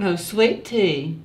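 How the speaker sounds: slow, lightly put-on Southern accent; mock Southern accent. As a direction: correct her with a slow Southern twang. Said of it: "I can do a Southern accent".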